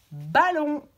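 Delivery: «ballon» said loud and distinctly; clear model word. Shown as a demonstration. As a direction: articulate «ballon» for listening. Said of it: "on dit juste les mots". («ballon» articulé fort)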